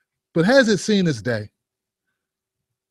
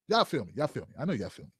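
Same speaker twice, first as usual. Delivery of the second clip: fading mutter; 7 semitones lower, 12 dB quieter than usual. Pretend. Fading low asides.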